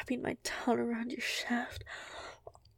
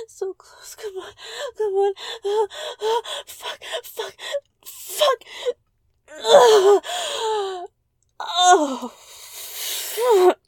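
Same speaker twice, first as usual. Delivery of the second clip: rising, louder cries to climax; climax moaning; 10 semitones higher, 13 dB louder, much slower than usual. Acted. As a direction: build to a screaming orgasm. Climax building to orgasm.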